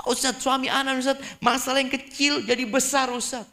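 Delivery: high-pitched wife whining to the ustadz; complaining wife. Acted. A wife reporting small troubles to her ustadz, high complaining voice. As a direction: bring a petty grievance to the teacher, pitched high.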